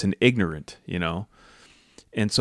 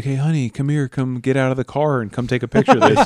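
mock beckoning; 5 semitones higher, 8 dB louder than usual. Jokingly beckons wife toward danger.

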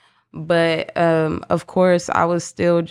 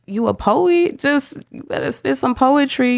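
mock self-challenge; 8 semitones higher than usual. Acted pep talk to herself.

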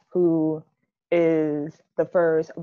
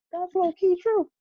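a squeaky high, playful put-on voice for the reply; mock high reply; 12 semitones higher than usual. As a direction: pitch it way up as a joking aside. Mock agreeing quote to online post.

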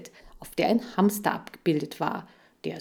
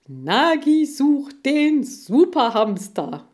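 high, loud, drawn-out slogan; big announcer proclamation; 9 semitones higher, 8 dB louder, slower than usual. A narrator's reading. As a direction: proclaim it after a huge breath. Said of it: "er holte so tief Luft".

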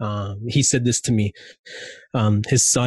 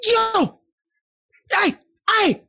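put-on yelling at girl. Yelling at a little girl in the pool, high urgent yell. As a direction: yell way up high to get her out. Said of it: "I yelled at her".

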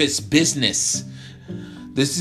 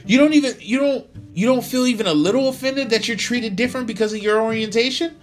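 shouted demand; 7 dB louder than usual. Acted. Tyler yells at John.

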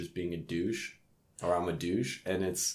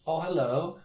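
higher-pitched mock British accent; bad British accent; 9 semitones higher than usual. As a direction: imitate a Brit, badly. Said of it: "That's the worst British accent".

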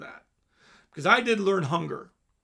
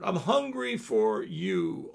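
"hungry" drawn out slow and low; slower, lower plea; 6 semitones lower, slower than usual. Shown as a demonstration. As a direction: repeat it slower and lower, pleading.

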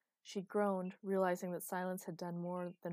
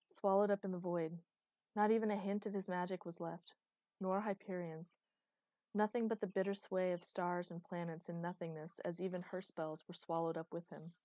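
ordinary delivery

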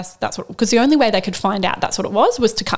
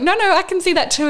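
higher, quick, delighted confirmation; a hint of an amazed doctor; excited doctor; 10 semitones higher, 3 dB louder, faster than usual. Doctor assuring the mother he sees the wave too, lightly excited.

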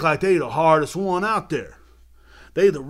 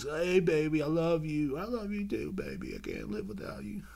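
soft, syrupy pet-name voice, much quieter; mock lovey-dovey couple; 8 dB quieter than usual. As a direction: coo it like a sappy couple mocking them. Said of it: "they always".

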